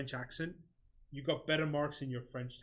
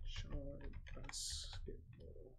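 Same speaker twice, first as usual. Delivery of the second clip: whispered to self; 14 dB quieter, much slower than usual. Real, not acted. Muttering a search to himself.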